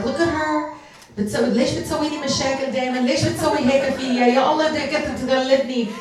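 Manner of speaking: mock a mother's fast, upset complaint. Daughter imitates her mother complaining about her, upset and fast. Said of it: "She's, like, so upset".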